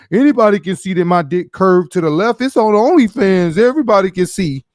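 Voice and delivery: loud, high mock of a man defending himself; loud indignant imitation; mocking Soldier's claim. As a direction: ham up an indignant man insisting on his proof.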